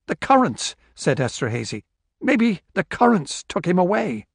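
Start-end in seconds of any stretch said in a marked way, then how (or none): 0.1-0.7 high-pitched, urgent suggestion; desperate insistence. A narrator's reading. Desperate man grasps at explanation. 2.2-4.3 high, pleading theory; desperate insistence. A narrator's reading. Desperate man grasps at explanation.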